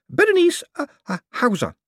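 high shy name; lady's voice. A narrator's reading. Lady gives her name.

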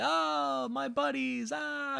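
put-on high, loud drunken cheer; sloppy happy drunk voice; drunk greeting.

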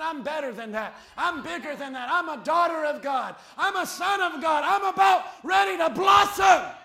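starts firm, swells to a loud triumphant cry; building declaration. Pretend. Believer declaring their worth to the critics, building to a shout. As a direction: grow bolder line by line until it bursts out.

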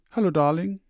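high, bright-voiced wife calling hello; wife's greeting. A narrator's reading. Wife greeting her husband home, high and loud. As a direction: greet him sweetly as the loving wife.

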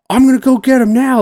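high, loud mock radio call. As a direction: call it in over the radio, hammed-up. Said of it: "he's like".

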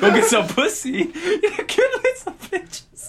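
high, laughing bro egging him on. Retold or imitated. Quoting a friend mocking a thesis, laughing high bro voice. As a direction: crack up while playing the crude friend. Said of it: "my friend was like".

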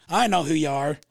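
loud, curt imitation of a grouchy customer; retold beastly greeting; moody woman. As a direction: cut him off brusquely, acting her part. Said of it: "a little bit of a moody woman".

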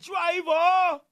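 loud Jamaican call of Driver; Jamaican patois. Retold or imitated. Greeting Jamaicans on vacation, loud Jamaican patois call. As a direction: shout Driver like the song. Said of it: "I be like".

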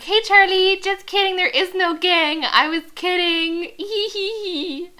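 high, loud, giggly impression; mock Bella. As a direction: parody a girl awkwardly laughing off a lie. Mocks Bella talking to Charlie.

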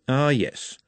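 ordinary delivery, nothing marked

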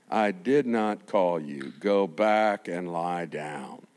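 put-on low, slow, calm old priest; old Eli. Old Eli answering young Samuel.

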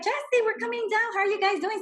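high, loud, cheery hello; excited greeting. Retold or imitated. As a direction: imitate friends calling with good news. Friends' happy call, retold.

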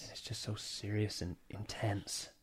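hushed and slow, imitating a true crime host; mock serious podcast whisper. Shown as a demonstration. To Chris, mocking murder podcast hosts, hushed whisper. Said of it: "I feel like they just talk like this".

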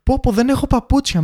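high, mock dismayed whine; mimicking women shoppers. Women complaining about shoes, high mock dismay. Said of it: "Ή το άλλο".

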